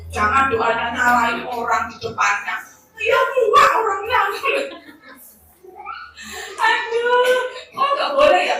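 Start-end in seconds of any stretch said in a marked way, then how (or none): 3.0-8.6 high, loud, tearful wife's protest; whiny wife. Retold or imitated. Preacher voicing the wife scolded over her prayer, high tearful whine. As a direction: wail it like a wife caught out.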